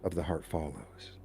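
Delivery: low, very soft, hushed meditation voice; guided meditation. Real, not acted. Leader settling a worldwide group into breathing together, guided meditation. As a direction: guide them gently into stillness, with long pauses.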